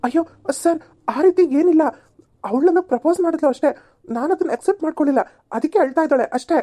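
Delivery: high, anxious explanation; panicked pleading. Acted. Accused man pleads with the policeman, panicked. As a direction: plead innocence fast.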